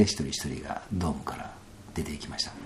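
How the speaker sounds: whispered, soft retelling; hushed. Retold or imitated. Narrating the sweat lodge exit in a whisper. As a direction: drop to a whisper as the people leave.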